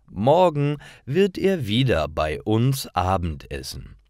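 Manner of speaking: ordinary delivery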